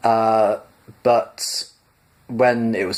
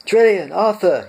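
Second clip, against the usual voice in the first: high, loud call across the bridge; excited yell to wake the crew; shouting; 10 semitones higher, 5 dB louder than usual. A narrator's reading. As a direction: shout their names urgently. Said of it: "he shouted".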